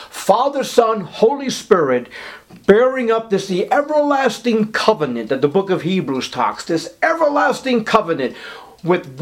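high, impassioned preaching on the covenant; preacher building to a pitch over the Godhead; rising preaching fervour. Acted. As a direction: preach it up with mounting fire.

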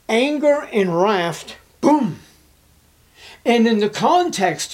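anger and wrath cried out high and loud; thundering wrath. Acted. Preacher describing God's wrath.

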